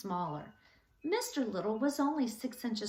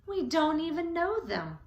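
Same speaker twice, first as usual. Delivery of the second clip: louder, higher indignant protest; grumpy outburst; 7 semitones higher, 6 dB louder than usual. A narrator's reading. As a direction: shake your cane and protest. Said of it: "He shook his cane in the air".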